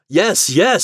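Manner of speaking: high, loud, eager assent; eager agreement. Acted. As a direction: agree eagerly as one of a crowd.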